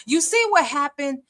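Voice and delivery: ordinary talk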